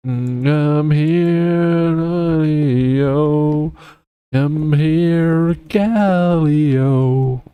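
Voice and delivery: high, slow, grand boast; heroic champion voice. Pretend. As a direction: announce yourself like a hero. Voicing Galio, game champion.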